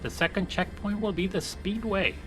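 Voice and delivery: ordinary talk, nothing marked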